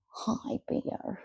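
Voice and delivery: soft whispered hello to the dog. Real, not acted. Greeting dog while others sleep.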